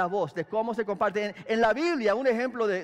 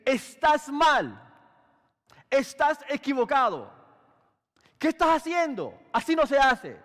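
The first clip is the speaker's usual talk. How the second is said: harsh correction; 4 semitones higher, 4 dB louder than usual. Shown as a demonstration. Pastor showing a rebuker's blunt scolding, harsh and loud. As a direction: scold him bluntly, no praise first. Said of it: "en vez de decir".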